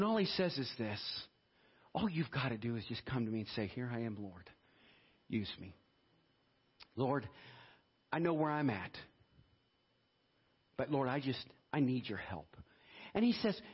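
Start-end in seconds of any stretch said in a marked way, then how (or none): 1.9-12.6 low, softened, simple plea; hushed, halting personal prayer; humble plain prayer. Acted. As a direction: speak quietly and simply to God.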